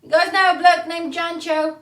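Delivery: high, loud shouted question; calling out. Acted. Asking soldiers about a bloke, hollered from afar. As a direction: holler it across to strangers.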